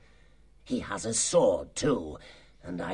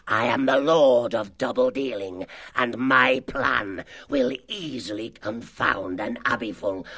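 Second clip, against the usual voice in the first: grandiose villain speech to his gang; sly, self-glorying fox chief; sly boasting. Acted. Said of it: "egged them on with his sly tongue".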